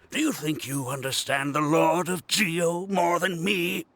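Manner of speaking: ordinary talk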